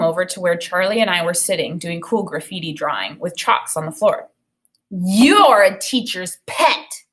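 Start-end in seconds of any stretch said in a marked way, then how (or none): 4.9-7.0 slow, loud, sneering taunt; spat out. A narrator's reading. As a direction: spit each word at him. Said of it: "He spat out the words".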